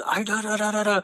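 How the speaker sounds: high, babbling mock yell; wordless imitation of a yell. Brother yelling at mom, a high babbling mock yell. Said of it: "He's just yelling".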